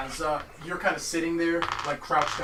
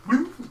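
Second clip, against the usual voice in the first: high yelp; 11 semitones higher, 7 dB louder than usual. Acted. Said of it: "people out in the water going".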